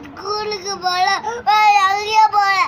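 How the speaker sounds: real crying. Real, not acted. Child refusing school to the adult consoling them, crying. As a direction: protest through sobs, drawing the words out. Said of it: "அழாத, அழாத".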